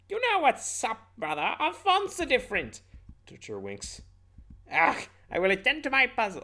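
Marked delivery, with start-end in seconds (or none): 0.1-2.8 very high, loud cartoon voice; exaggerated, sky-high character voice; Papyrus. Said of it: "I'm gonna do the papyrus voice". 4.7-6.4 high, loud cartoon huff; exasperated, pitched-up character voice; Papyrus. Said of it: "I'm gonna do the papyrus voice".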